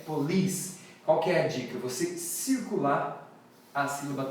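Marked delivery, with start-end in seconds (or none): 0.0-0.8 police said slowly as po-LEECE; stress on second syllable. Shown as a demonstration. Teacher explaining the stressed syllable, stress on second syllable of police. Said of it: "a stressed syllable é".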